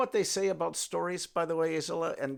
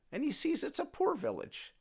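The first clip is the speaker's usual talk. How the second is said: ordinary delivery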